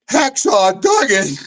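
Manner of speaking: high, slow amazed shout of a name; stunned recognition. Retold or imitated. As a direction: blurt the famous name in disbelief. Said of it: "the other guy's like".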